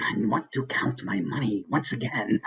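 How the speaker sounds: gloating dragon counting treasure; miserly dragon voice ending in a chuckle; greedy dragon. Shown as a demonstration. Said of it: "Or maybe he's".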